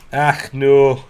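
loud, high Scottish outburst; sudden Scottish yelp of dismay; turned Scottish. Real, not acted. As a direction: cry out in a Scottish accent. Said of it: "Don't know why I turned Scottish".

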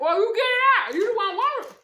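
loud, high-pitched comeback; loud retort. Real, not acted. Host telling the asker to get it out, loud retort. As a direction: fire it back at someone, voice jumping up. Retort to person asking for more.

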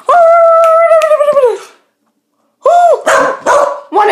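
high, loud squealing play voice; shrieking excited baby talk to the cat; excited pet talk. Acted. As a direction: whip the kitten into a frenzy.

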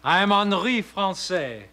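lightly put-on fake French accent; a critic hamming up a French accent; phony French accent. Critic introduces himself to the young painter in a phony French accent. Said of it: "a phony accent like this".

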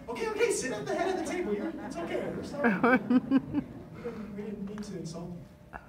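high, fast, placating voice that quickly falls back; flustered apology. Retold or imitated. Meeting staff hurrying to appease an angry visitor, flustered and high. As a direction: imitate staff scrambling to calm him down.

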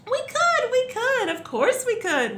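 very high, loud eager chorus; excited storybook cry; shouting. A narrator's reading. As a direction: shout it out eagerly all together. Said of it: "we all shouted".

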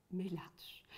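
breathy, hushed whisper of the word. Shown as a demonstration. Children's talk leader whispers the scary word to the kids. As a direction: whisper it like a secret.

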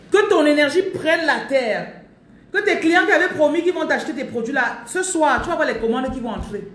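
loud fervent proclamation; fervent preaching. Real, not acted. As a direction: proclaim it with full force. Preacher declaring blessing at full force.

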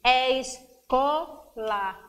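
"escola" slowly in three beats; stretched, separated syllables in "escola". Shown as a demonstration. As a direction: tap out "escola" as es, co, la. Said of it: "Um, dois, três".

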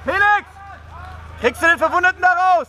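loud, fast battlefield shout. Real, not acted. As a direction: yell it over gunfire. Calling a teammate in battle.

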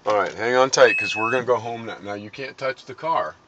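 ordinary talk

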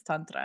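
Tantra with an open a, like "TAHN-tra"; Spanish-tinged Tantra. Shown as a demonstration. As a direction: say Tantra with a light Spanish accent. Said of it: "my Spanish speaker influence".

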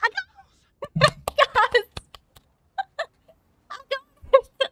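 high, loud squeals broken by laughter; overjoyed, near tears. Real, not acted. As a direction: squeal it, laughing, on the edge of crying. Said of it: "I'm gonna cry".

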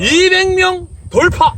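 excited. Real, not acted. Celebrating 200 subscribers.

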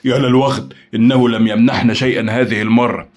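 loud, indignant exclamation; exclaiming. A narrator's reading. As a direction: cry out in frustration. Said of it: "فهتف أكرم".

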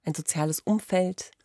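ordinary delivery

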